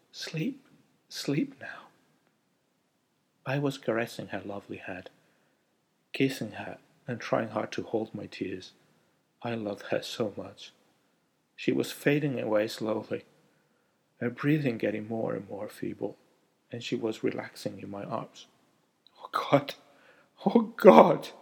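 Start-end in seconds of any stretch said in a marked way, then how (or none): 0.1-1.9 soothing whisper. A narrator's reading. Husband soothing dying wife. 19.2-21.3 breathy then loud anguished cry. A narrator's reading. Husband as wife dies.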